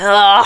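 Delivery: drawn-out mock retching groan; disgusted groan. Listener reacting to a corny book line, disgusted groan. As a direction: let out an exaggerated groan of disgust.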